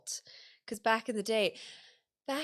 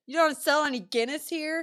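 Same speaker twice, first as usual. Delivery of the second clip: mock tourist question; 7 semitones higher, 5 dB louder than usual. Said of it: "or it's like".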